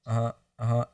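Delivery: mock passive listener's murmur; shut-down nodding along. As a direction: mimic someone numbly going along. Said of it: "instead of shutting down and sitting there going".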